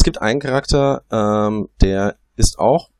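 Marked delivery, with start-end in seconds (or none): none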